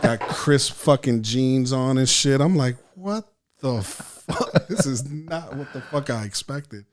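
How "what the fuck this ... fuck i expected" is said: high, drawn-out, hushed "what the"; baffled, stretched-out reaction; stunned disbelief. Retold or imitated. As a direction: retell it as slow, astonished disbelief. Reacting to unexpected sight of man.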